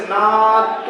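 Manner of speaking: नाद with a drawn-out nasal AA; नाद slowly, the vowel held long; nasalised vowel. Shown as a demonstration. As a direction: stretch नाद through nose and mouth together. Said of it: "हवा मुंह से भी निकल रही है और नाक से भी".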